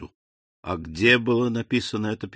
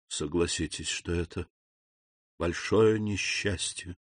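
muffled voice; 5 semitones lower, 9 dB quieter than usual. A narrator's reading. Shaken abbé speaks of a great misfortune, in a muffled voice. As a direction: say it quietly in a dull, sunken voice. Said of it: "сказал он глухим голосом".

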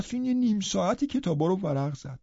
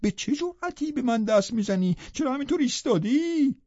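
furious shout; 7 semitones higher than usual. A narrator's reading. Catherine rounds on Hareton for touching her hair, shouting high. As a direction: shove him away with your voice. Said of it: "داد کشید".

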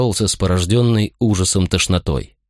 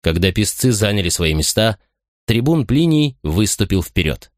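plain reading voice

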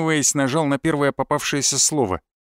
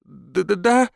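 higher, nervous stuttered reply; stammering; 4 semitones higher, slower than usual. A narrator's reading. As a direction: stutter a timid question. Frightened nerd stammers a reply. (робко, с заиканием)

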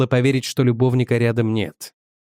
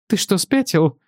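wife's voice; 5 semitones higher than usual. A narrator's reading. Wife voiced high.

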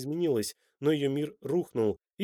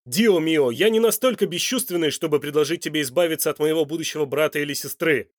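loud, heated, raised voice; angry retort; 3 semitones higher, 9 dB louder than usual. A narrator's reading. Son rejects his mother's fear of him, angrily. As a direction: fire back indignantly. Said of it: "сердито бросил в ответ Данте".

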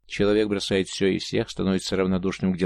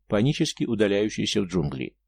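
ordinary delivery

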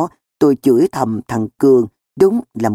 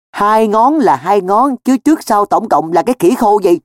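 high, heated retort; cross, incredulous challenge; exasperated; 7 semitones higher, 4 dB louder than usual. Acted. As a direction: demand sense from a muddled excuse. Exasperated friend in quarrel.

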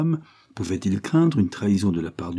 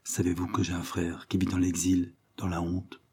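hushed and much softer, almost to herself; lowered voice; 2 semitones lower, 7 dB quieter than usual. A narrator's reading. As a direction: drop the voice, confiding a secret. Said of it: "elle baissa la voix".

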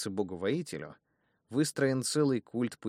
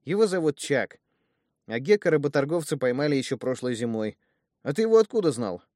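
put-on a lively, loud boy talking fast and keen; keen, a bit louder, eager to explain; eager youth; 6 semitones higher, 8 dB louder than usual. Young thug tells Danmar about his brother, eager and loud.

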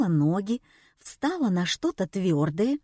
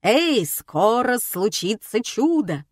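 magician announcing; 2 semitones higher, 3 dB louder, slower than usual. A narrator's reading.

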